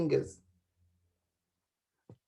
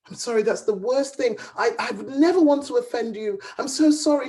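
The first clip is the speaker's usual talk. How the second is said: anxious apology; 7 semitones higher, 4 dB louder than usual. Acted. Hunter fears he offended her.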